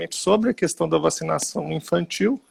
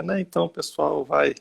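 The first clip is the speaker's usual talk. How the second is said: ordinary talk, nothing marked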